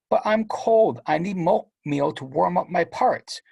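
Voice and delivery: put-on high, pleading little-boy voice; whiny little kid. Little brother begging Steven for warm oatmeal, high and whiny. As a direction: whimper it like a cold small boy.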